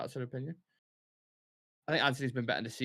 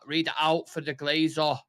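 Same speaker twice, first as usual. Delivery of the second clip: imitated thick Manc accent, slower and louder; strong Mancunian accent; 3 semitones higher, 5 dB louder than usual. Imitating a guy reading a poem, strong Mancunian accent. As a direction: do an exaggerated Mancunian poem reader. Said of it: "Like, that's how it sounded. It was so strong".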